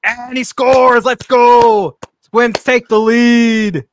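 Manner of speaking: loud, high, rising home-run call; excited play-by-play call. Acted. Streamer calling the Twins run to chat, excited. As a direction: call the score like a hyped announcer.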